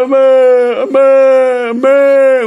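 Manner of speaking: loud, high, long mooing wail; bawling calf. Acted. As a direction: bawl for mama like a calf. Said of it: "뒤에서 막 우는 거예요". (송아지가 목 놓아 우는 소리)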